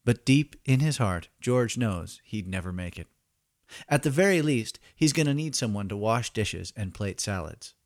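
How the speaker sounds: usual speaking voice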